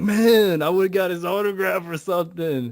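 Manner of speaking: mock-mad outburst, pitched high; high, exasperated retold whine. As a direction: imitate a friend's frustrated complaint, starting loud. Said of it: "And he was mad".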